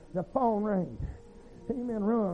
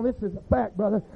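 usual speaking voice

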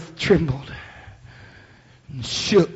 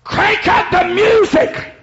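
much higher, slow, shouted; devil's party shout; 5 dB louder, slower than usual. Acted. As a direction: whoop like the devil throwing a party. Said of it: "it sounded like this in hell".

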